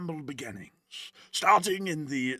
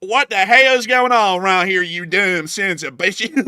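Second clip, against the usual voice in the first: mock Southern yell; 3 semitones higher, 8 dB louder than usual. Candidate yells at Southeast voters in a mock Southern accent. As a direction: bellow it like an angry good ol' boy. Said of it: "transplant accents wherever I'm at".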